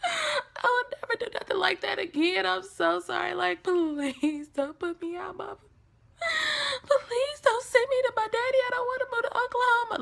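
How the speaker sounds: high, tearful, begging sobs; crying plea. Retold or imitated. Teen sobbing and pleading with mom not to send her away. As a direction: beg mom through tears not to be sent away. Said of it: "And I'm just crying".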